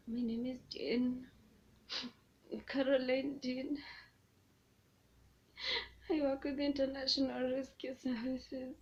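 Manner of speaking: performed confession, tears building; put-on tearful confession. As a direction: recite a forced confession on the edge of sobbing. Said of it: "is this how you want me to say".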